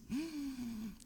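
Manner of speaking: sharp high gasp of fright; fearful gasp. Retold or imitated. Boy dreading his father's talk, a frightened gasp. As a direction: suck in air like a scared kid.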